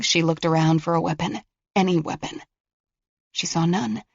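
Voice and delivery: ordinary delivery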